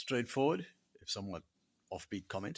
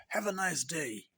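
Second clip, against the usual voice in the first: higher-pitched mock-cheery send-off; put-on cheeriness, lifted pitch; cheery greeting; 6 semitones higher than usual. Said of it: "a cheery greeting along the lines of".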